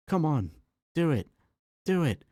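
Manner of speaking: giddy goading, rising each time; a drunk prankster pushing his buddy; egging on. Acted. Drunk man eggs on friend.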